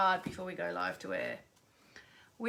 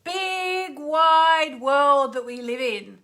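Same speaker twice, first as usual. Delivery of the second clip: loud, higher, stretched-out radio greeting; big show opening; 5 semitones higher, 10 dB louder, slower than usual. Real, not acted. Host opening her radio show to listeners, big drawn-out greeting. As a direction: belt out a long welcome to the whole world.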